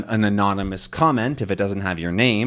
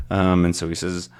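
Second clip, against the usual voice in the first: usual speaking voice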